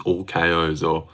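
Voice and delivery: normal talking voice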